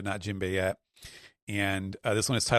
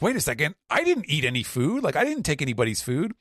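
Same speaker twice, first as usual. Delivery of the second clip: a touch higher, quick protest; mildly indignant; 7 semitones higher, faster than usual. Retold or imitated. Buggy denying taking food, quick indignant protest. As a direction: protest your innocence fast. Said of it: "Buggy's like".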